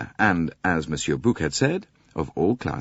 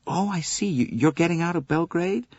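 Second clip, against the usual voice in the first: put-on young man voice; 12 semitones higher than usual. McQueen asks, higher voice.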